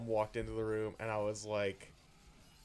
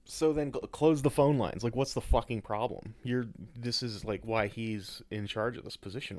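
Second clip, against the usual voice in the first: ordinary talk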